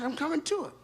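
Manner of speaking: high-pitched stalling guide; squeaky flustered guide. Acted. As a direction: voice jumps way up as he stalls her. Guide stalls the lady again.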